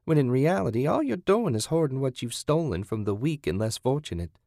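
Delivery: high-pitched, preachy captor; scornful captor. Acted. Kathy lecturing captive on hypocrisy, scornful. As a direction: berate respectable society with contempt. Voices Kathy's long tirade.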